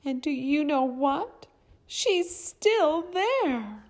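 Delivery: very high, loud little bear, drawn out at the end; high, cute baby bear cry; itty-bitty Baby Bear. A narrator's reading. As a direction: squeak out the discovery as a tiny cub. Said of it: "in his itty-bitty voice".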